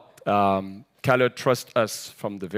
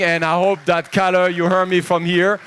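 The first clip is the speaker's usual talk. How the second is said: loud, high, rousing toast; roaring party toast; excited shout; 7 semitones higher, 7 dB louder than usual. Real, not acted. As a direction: shout it out to the whole room, full of energy. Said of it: "I need to spread this energy out".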